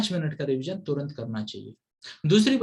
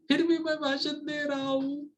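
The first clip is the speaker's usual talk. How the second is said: laughing at himself; 10 semitones higher than usual. Real, not acted. Self-mocking joke about his speech.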